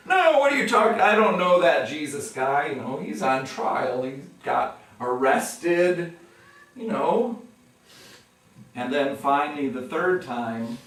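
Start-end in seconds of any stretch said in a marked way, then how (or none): 0.1-7.4 loud, high panicky brush-off; flustered denial. Retold or imitated. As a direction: wave it away, flustered. Peter denying a second time.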